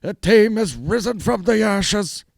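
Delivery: high, slow booming ring announcer; hammed-up arena announcer build-up. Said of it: "doing that Bruce Buffer".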